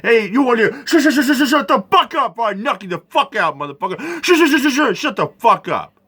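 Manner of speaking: high, stuttering outburst; mock angry retort. Telling the shushing pastor off, stuttering and angry. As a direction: parody the stutter, then explode. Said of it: "I was like".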